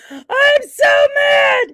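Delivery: very high, loud, slow squeal; playful outraged squeal; mock angry shriek. Real, not acted. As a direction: shriek out fake fury.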